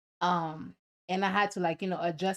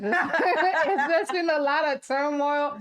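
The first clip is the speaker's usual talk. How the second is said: high, drawn-out words through laughter; helpless laughter breaking into the words; laughing complaint; 7 semitones higher, 4 dB louder, slower than usual. Real, not acted. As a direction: laugh your way through a joking grievance. Joking that life has been terrible.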